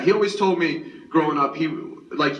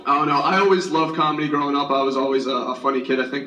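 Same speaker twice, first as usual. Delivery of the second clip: ordinary talk, nothing marked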